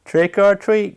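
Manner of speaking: a lightly quacky duck; quacked. A narrator's reading. Duck to the squirrel, a light quack. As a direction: call it out like a duck. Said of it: "quacked Duck".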